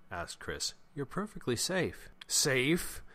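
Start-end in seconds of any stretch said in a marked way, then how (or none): 0.9-2.1 high, insistent voice of a worried friend; high-pitched reassurance. Acted. Chris reassures panicking Jeremy. 2.3-3.0 high, incredulous question; rising voice. A narrator's reading. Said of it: "Jeremy's voice rose".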